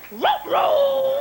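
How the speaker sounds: high, loud strained row chant; a hammed-up desperate rower; mock rowing call. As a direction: cry it out like someone drowning. Mock sinking lawyer rowing.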